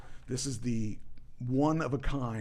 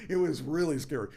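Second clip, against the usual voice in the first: louder, through real laughter; laughing; 3 semitones higher, 6 dB louder than usual. Real, not acted. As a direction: say it while still laughing. Laughing while recalling the scare.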